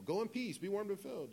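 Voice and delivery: high, lilting stock farewell; sing-song passing blessing; breezy common blessing. Acted. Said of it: "a common blessing that people would say".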